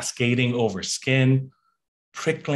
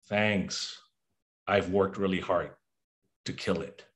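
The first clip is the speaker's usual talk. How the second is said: softer and lower, fading; quiet bitter; 5 semitones lower, 7 dB quieter than usual. Real, not acted. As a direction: let it fade, bitter.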